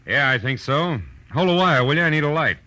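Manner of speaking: detective calling away from the phone to the caller. Acted. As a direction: call it across the office. Calling across the room, phone.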